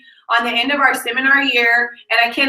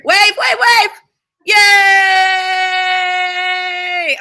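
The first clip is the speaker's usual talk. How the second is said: excited cheer; 5 semitones higher, 5 dB louder, much slower than usual. Real, not acted. Cheering at the live camera.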